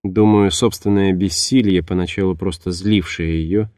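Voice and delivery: ordinary delivery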